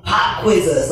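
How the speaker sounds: ordinary talk, nothing marked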